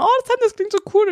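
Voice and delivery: high, gushing mock-admiring voice; mock gushing outsiders. Mocking outsiders who find the Berlin dialect cool, high and gushing. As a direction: gush like an outsider charmed by the dialect. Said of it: "Und, und ihr denkt immer".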